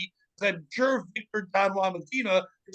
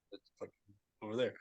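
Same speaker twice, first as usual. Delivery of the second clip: acted very soft, low aside; hushed tip-off; 9 semitones lower, 14 dB quieter, much faster than usual. Kid pointing out the hiding fugitive, hushed tip-off. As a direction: quietly give away a secret, playing it up.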